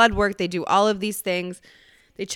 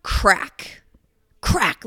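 ordinary talk, nothing marked

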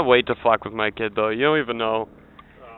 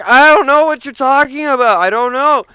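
put-on much higher, louder protesting child; confused kid; 12 semitones higher, 5 dB louder than usual. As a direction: kid denying it, half asleep. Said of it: "Be like".

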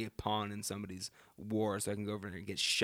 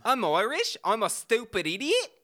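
high, loud mock Irish; bad Irish accent; 10 semitones higher, 5 dB louder than usual. Host mocks co-host's Irish attempt, high, loud, bad Irish. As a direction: squawk a terrible Irish accent. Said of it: "Do an Irish accent".

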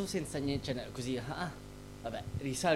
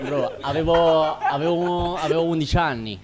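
loud, higher voice breaking into laughter; laughing protest; 4 semitones higher, 11 dB louder than usual. Real, not acted. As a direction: try to explain yourself through real laughter. Ride mentre si giustifica con amici. (protesta ridendo, a voce alta)

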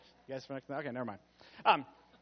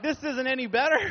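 loud laughing retelling; tickled by his own mishap; 6 semitones higher, 9 dB louder than usual. Real, not acted. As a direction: laugh through the line. Laughing at his beard story.

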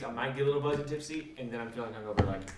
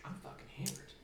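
breathy whisper to himself; whispered realization; 6 semitones higher, 13 dB quieter than usual. Retold or imitated. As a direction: whisper it in drunken disbelief. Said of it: "you realize".

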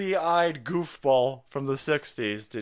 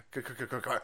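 ordinary talk

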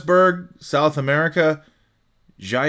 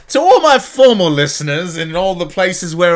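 laughing while apologising for the accent; laughing through words; 3 semitones higher, 7 dB louder than usual. Real, not acted. Podcaster laughing at his own bad British accent. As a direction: giggle through the line. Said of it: "done it terribly".

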